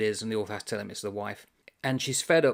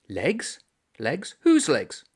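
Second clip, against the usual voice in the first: WHOSE rising to a louder, higher question; puzzled repeat; 4 semitones higher, 5 dB louder, much slower than usual. Acted. Reader voicing the puzzled Irishman, building bafflement. Character puzzled, voiced in reading.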